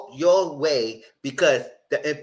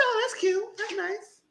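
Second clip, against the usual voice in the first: much higher, mock-sweet and fading softer; sarcastic sweetness; 4 dB quieter, slower than usual. Host mocking Charlie's long-distance plan, sarcastic and high. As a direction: coo it with fake approval. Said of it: "I said".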